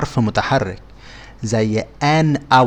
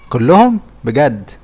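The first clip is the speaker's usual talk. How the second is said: mock surprise; 2 semitones higher, 7 dB louder than usual. Teacher pretends to be amazed the viewer got all right. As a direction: act amazed at the viewer's score.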